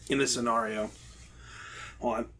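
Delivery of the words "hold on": choking on water, straining; strained by coughing up water. Real, not acted. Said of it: "Water went down the wrong one pipe".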